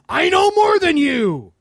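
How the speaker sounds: high smug voice, slowly; mock the arrogant know-it-all.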